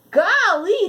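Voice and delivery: high, loud delighted "Golly"; exclaiming. A narrator's reading. Boy marvels at nineteen grandkids, loud delighted exclamation. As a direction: let the wonder burst out. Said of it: "the boy exclaimed".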